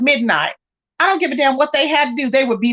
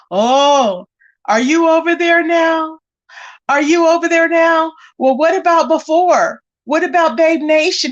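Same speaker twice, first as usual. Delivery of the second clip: mock whine, a bit higher and drawn out; whining; 3 semitones higher than usual. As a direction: imitate his needy, whining questions. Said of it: "He was over there whining".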